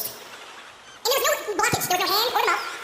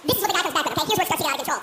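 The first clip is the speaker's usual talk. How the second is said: usual speaking voice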